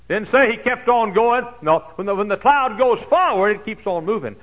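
high, loud fervent preaching; preaching at full force. Acted. Pastor preaching to his congregation, full force. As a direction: drive the sermon home to the crowd.

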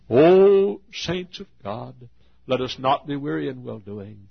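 high, ringing call to the saints; preaching exhortation. Real, not acted. Preacher urging saints not to grow weary, forceful exhortation. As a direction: open loud and drawn out, preach with force.

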